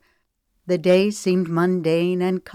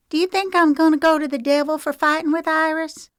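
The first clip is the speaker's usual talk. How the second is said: put-on much higher, fast anxious child voice; a small child blurting out her worry; worried little girl; 10 semitones higher, 5 dB louder, faster than usual. Agnes asks Shelby about the devil, quick worried child voice.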